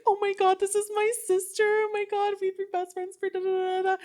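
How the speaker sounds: very high, loud fake-crying squeal; mock tearful gushing. Friend announcing her sister to the nurses, mock-tearful squeal. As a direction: wail it in a piercing high voice, half crying. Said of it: "crying, but, like, not really".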